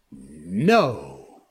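low, ringing, scornful no. Acted. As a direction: toss the answer off with contempt. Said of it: "rang out scornfully".

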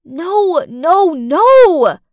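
high, loud protest; desperate no. Acted. Cindy to Cass, a high loud desperate denial. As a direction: cry it out in denial. Denying the lie.

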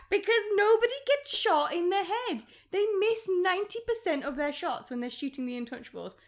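high, loud incredulous complaint; exasperated rant. Real, not acted. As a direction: raise your pitch and protest the absurdity. Exasperated rant about bad aim.

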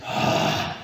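voiceless, breathy groan of dread; collective audible gasp. Retold or imitated. Exhausted team hearing the coach's punishment, imitated audible gasp. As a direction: imitate the whole team's dismayed exhale. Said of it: "heard in all of us an audible just".

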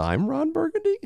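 imitated uptalk, very high finish on BURGUNDY; rising intonation. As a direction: make BURGUNDY shoot up like a question. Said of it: "you do this thing".

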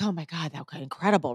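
moved after the mother's thanks, softly; hushed awe. Retold or imitated. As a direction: breathe it out in quiet awe. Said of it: "And I'm like".

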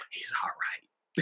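breathy slow whispered reassurance; hushed, drawn-out mock whisper; imitated whisper. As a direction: whisper it slowly as the tipsy girl. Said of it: "she's splashing water on him".

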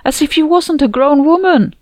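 high, loud, indignant outburst; outraged. A narrator's reading. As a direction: vent the outrage loudly. Outraged retelling of the funeral.

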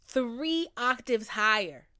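loud, slow, stretched-out words; drunk self. Retold or imitated. Explaining how she sounds drunk, loud drawn-out mock drunk. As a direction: draw it out loudly like a happy drunk.

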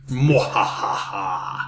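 slow, low villain cackle; evil laugh. Acted. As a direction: Mwahaha like a cartoon villain. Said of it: "That turned out pretty evil at the end".

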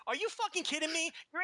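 high-pitched, indignant disbelief; incredulous outrage. Real, not acted. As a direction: react as if you can't believe the nerve. Indignant at the quoted interview question.

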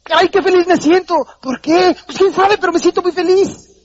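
hammed-up blissful gushing; gushing happy voice. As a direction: overflow with silly joy. Acting out a happy person.

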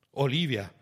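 high, loud outburst on the name; shocked exclamation. A narrator's reading. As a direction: blurt it out in shock. Offerta rifiutata, nome esclamato con sdegno. (esclamazione sconvolta, alta e forte)

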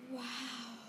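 a soft, breathy-quiet sighed wow; hushed awe at the broom; awed sigh. A narrator's reading. Ron seeing the broomstick on the bedspread, a soft sighed wow. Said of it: "Ron sighed".

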